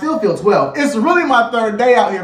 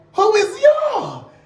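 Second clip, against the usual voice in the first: offended shout; 11 semitones higher, much slower than usual. Acted. Answering the thirteen-year-old cousin, indignant high shout. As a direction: fire it back, indignant.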